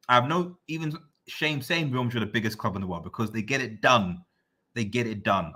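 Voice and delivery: ordinary talk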